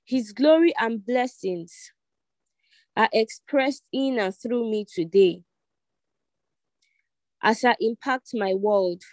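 measured prayer in short phrases with long pauses; led prayer. Real, not acted. Online congregation repeating after the leader, measured led prayer. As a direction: lead the prayer phrase by phrase, pausing for repetition.

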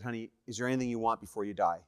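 low, hushed and quick; soft bedside question. Retold or imitated. Husband to dying wife at bedside, soft and low. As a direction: ask it gently, close to her ear.

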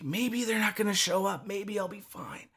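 whisper-soft self-reassurance, acted out; retold tentative hope. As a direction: think aloud very softly, hoping. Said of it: "starting to get hope".

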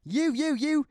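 sputtering high, loud chef outburst; stammer, then explode at the cook; Gordon Ramsay. Acted. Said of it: "best Gordon Ramsay impression".

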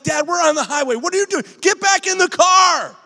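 high, rising into a loud yell; panicked son yelling at his father on the highway; yelling at dad to get back in the car; frantic shout at dad. Retold or imitated. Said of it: "and I'm like".

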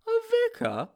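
startled sidekick, pitch shooting up; high-pitched bewildered character voice; Sponge, baffled. A narrator's reading. Sponge's baffled question in story.

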